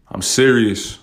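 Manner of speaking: usual speaking voice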